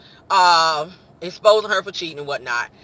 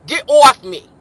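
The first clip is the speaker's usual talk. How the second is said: loud, higher New York holler; New York accent; 6 semitones higher, 11 dB louder, slower than usual. Acted. [name] pushing him off, loud New York accent. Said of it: "You gotta have that accent".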